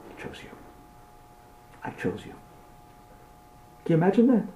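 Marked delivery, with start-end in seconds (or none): 0.1-2.3 barely audible, intimate whisper; very soft, hushed reveal; awed whisper. Acted. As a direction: whisper it as a solemn secret. Climax of being chosen.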